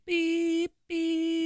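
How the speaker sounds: high, monotone beeping; truck reversing beep. Retold or imitated. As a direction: imitate a backing-up truck's beeper. Imitates truck reversing beeper.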